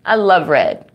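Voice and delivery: plain reading voice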